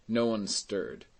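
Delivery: usual speaking voice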